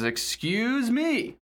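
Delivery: high, slow and drawn out; a hint of a haughty Cheshire Cat, drawn out slowly; prissy high voice. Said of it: "And he says".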